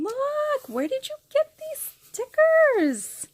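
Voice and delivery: high, loud delighted squeal; excited squeal. Real, not acted. Opening a swap package, squealing with joy. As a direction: squeal with real delight at the gift. Unboxing happy mail, squeals at stickers.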